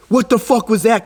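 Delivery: high, alarmed outburst; imitating his own panicked yell; startled. Strange noise while driving.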